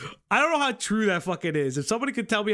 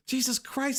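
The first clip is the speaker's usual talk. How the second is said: ordinary delivery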